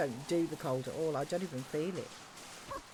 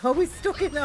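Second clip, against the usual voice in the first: laughing; 9 semitones higher, 9 dB louder than usual. Real, not acted. Laughing at a stuck rabbit.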